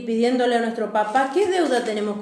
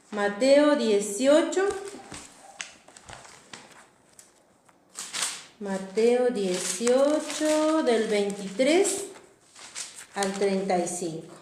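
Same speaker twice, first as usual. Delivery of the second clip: slow announcement; 4 dB quieter, slower than usual. Acted. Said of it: "vamos a buscar en Mateo dieciocho".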